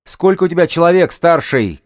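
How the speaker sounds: loud, high shouted question. A narrator's reading. Woman leader questions the gang's elder, high loud shout. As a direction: call out to the far side. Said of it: "крикнула Ханна".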